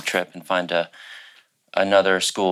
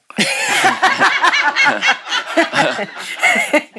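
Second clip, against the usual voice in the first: long high laugh; 4 dB louder than usual. Real, not acted. Laughing at rejection story.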